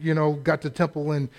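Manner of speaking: usual speaking voice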